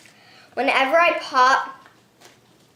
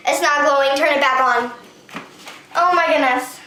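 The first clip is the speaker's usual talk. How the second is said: ordinary talk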